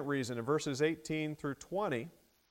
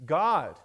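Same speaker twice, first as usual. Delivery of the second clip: "God" punched out loud on its own; emphasis on God; 8 dB louder than usual. Shown as a demonstration. Preacher naming the emphasized word to the congregation, "God" stressed. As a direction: hit "God" hard after a pause. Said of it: "the emphasis there is on".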